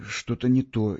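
usual speaking voice

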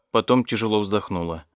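ordinary delivery

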